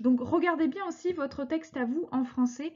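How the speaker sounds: ordinary talk, nothing marked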